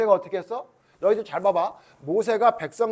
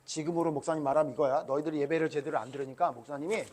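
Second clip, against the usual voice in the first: normal talking voice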